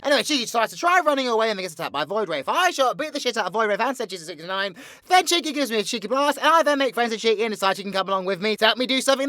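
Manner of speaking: high, loud, rapid-fire villain storytelling; excitable theatrical villain rattling off a plot; villain's breakneck recap. Acted. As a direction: race through your exploits with showman's gusto. V recaps the series in character.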